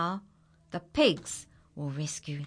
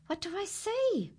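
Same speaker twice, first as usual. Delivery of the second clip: surprised question; 13 semitones higher than usual. A narrator's reading. Narrator spots the goat climbing.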